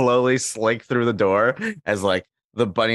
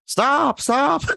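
Kermit yelling. Retold or imitated. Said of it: "Kermit's like".

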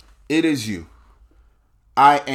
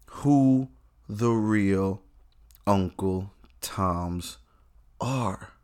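slow and softer, weighty; dramatic slow reveal; 3 semitones lower, 5 dB quieter, slower than usual. Real, not acted. Comic building to a solemn slow punchline. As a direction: lay it down slowly like a revelation.

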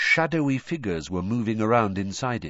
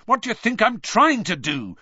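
higher, louder angry retort; 12 semitones higher, 6 dB louder than usual. A narrator's reading. Harry snaps at Hermione, angry and loud. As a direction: snap back in frustration. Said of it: "said Harry angrily".

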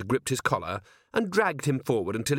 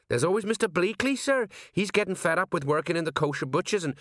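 high-pitched, dutiful underling voice; high, respectful sergeant reporting; eager deferential sergeant; 7 semitones higher than usual. A narrator's reading. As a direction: report to the boss, voice pitched well up. Sergeant's character voice.

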